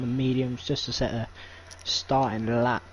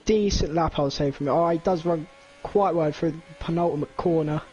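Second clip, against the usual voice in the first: live commentary; 4 semitones higher than usual. Acted. Live lap play-by-play.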